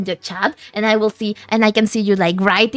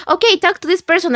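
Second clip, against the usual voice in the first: higher, brisk nudge; friend's bossy suggestion; 8 semitones higher, 4 dB louder, faster than usual. Retold or imitated. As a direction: push her to message someone. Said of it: "she was like".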